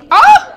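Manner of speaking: loud high yelp; startled shriek. Real, not acted. Startled by the cat: loud high shriek. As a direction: shriek out suddenly.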